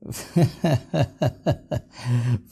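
long, real chuckle; laughing. Real, not acted. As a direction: laugh at your own joke, drawn out. Joke that his wife heard him.

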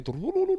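very high "tu tu tu tu" sound effect; high playful bird noise; flying-off noise. Retold or imitated. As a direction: squeak it out like a bird flitting away. Imitating pigeon flying off.

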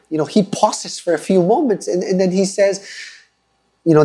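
ordinary talk, nothing marked

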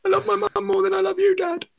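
put-on a little boy rejecting his dad, high and fast; mock child voice. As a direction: squeak it out like a small kid. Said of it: "you know".

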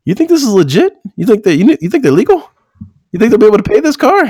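high, scoffing mock of a doubtful salesman. Salesman asking his manager about Johnny, high and scoffing. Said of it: "he's like".

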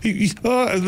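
high, stammering imitation of the grandma; flustered Scottish grandma. Grandma contradicting her family, imitated in a high flustered voice. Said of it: "She's like".

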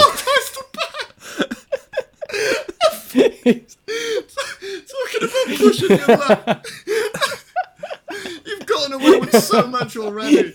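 loud, high laughing exclamations; incredulous words bursting through laughter; cracking up. Real, not acted. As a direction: squeal it out through real laughter. Cracking up at villain's behaviour.

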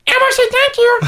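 very high squeaky Elmo thanks; a sweet little Elmo thank-you. Retold or imitated. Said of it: "the Elmo voice".

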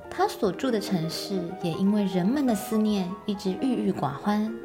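plain reading voice

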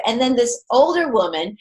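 usual speaking voice

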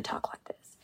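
hushed, whispered delivery; slow Ruth tone. Shown as a demonstration. Actress showing Ruth's second tone, slow and hushed. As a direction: drop into a whisper. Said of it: "really slow".

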